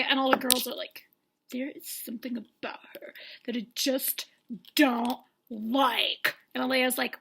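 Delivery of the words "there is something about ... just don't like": hushed, halting suspicion; mock sinister whisper. Girls confiding their dislike of her, mock sinister whisper. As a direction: whisper it with dramatic pauses.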